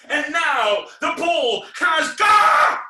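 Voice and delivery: loud, high, slow showman's build; hammed-up triumphant announcement; shouted reveal. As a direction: stretch it out to a huge final yell. Big reveal of the trick.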